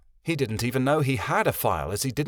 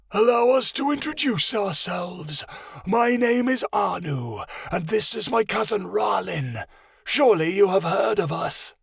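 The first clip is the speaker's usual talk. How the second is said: gloating lizard voice; 8 semitones higher than usual. Acted. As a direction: introduce yourself like a smug beast. Monitor lizard's voice.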